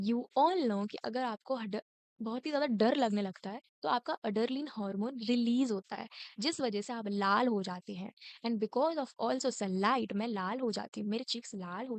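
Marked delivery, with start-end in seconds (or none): none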